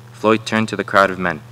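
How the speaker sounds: ordinary talk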